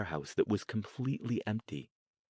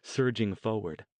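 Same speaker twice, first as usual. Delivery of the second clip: plain reading voice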